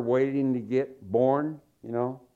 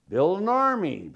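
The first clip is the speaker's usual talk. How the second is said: ordinary delivery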